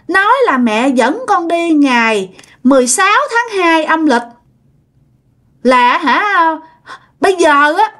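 put-on high little-child voice; toddler voice. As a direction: announce it like a small kid, then rush the age. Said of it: "giống như đứa con nít".

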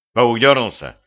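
usual speaking voice

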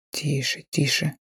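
hoarse hushed whisper; хриплый шёпот. A narrator's reading. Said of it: "шепчу хрипло". (хрипло, шёпотом)

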